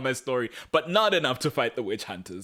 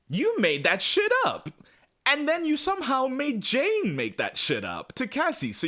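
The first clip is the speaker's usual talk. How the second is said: mock accusation. [name] accusing John.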